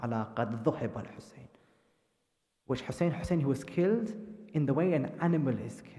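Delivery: low, soft grieving recital; hushed sorrowful storytelling; mournful narration. A narrator's reading. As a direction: tell it quietly, as a lament.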